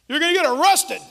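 high, loud warning from a doubting dad; alarmed father. Retold or imitated. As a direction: shout it like a worried father who can't believe it. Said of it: "My dad".

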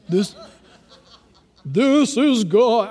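slow, drawn-out mock voice of God. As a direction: stretch it out like a hammed-up God. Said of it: "I don't think God talks like that".

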